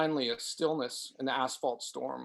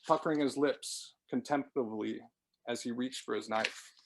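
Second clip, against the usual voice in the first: ordinary delivery, nothing marked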